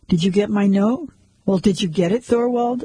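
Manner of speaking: ordinary delivery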